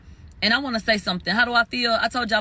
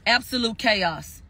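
usual speaking voice

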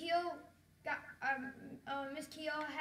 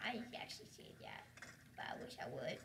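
whisper; 14 semitones lower, 11 dB quieter than usual. Real, not acted. Whispered aside to viewers.

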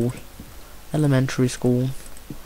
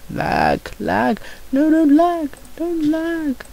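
squeaky high whine at the lag; whiny plea; 13 semitones higher, slower than usual. Pretend. Gamer whining at the game to stop lagging, squeaky. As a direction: whine like a frustrated kid.

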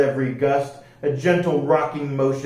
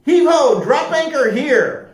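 rousing storybook pirate roar; roared command; 12 semitones higher, 6 dB louder than usual. A narrator's reading. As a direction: holler it across the ship. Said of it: "and roared".

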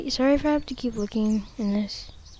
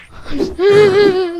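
nervous laugh; 10 semitones higher, 11 dB louder than usual. Real, not acted. Relief after a scare.

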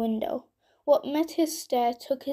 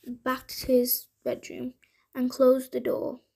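ordinary delivery, nothing marked

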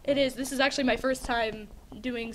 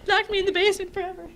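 laughing; 9 semitones higher, 6 dB louder, faster than usual. Pretend. Joking complaint about her mother.

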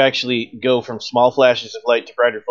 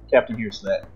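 normal talking voice

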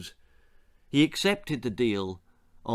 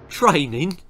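scoffing; 5 semitones higher, 6 dB louder than usual. A narrator's reading. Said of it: "scoffed Ollie".

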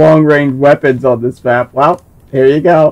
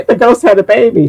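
ordinary talk, nothing marked

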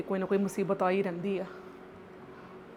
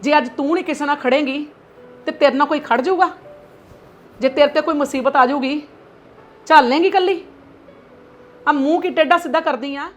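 angry lecturing mother. Acted. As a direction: scold her daughter hard. Mother lectures daughter angrily.

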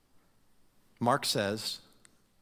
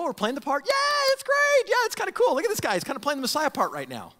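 much higher, breezy mock excitement; casual enthusiasm; 10 semitones higher, 4 dB louder, faster than usual. Shallow onlookers enjoying the spectacle, much higher breezy gush. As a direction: gush lightly, enjoying it as a show. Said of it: "They just kinda go".

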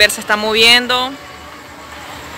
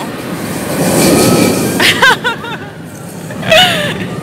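high shouting laugh; 11 semitones higher than usual. Real, not acted. Reacting to the roller coaster.

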